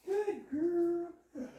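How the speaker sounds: high, bright marker "Yes" then praise. Real, not acted. Trainer rewarding the dog for sitting, high and excited.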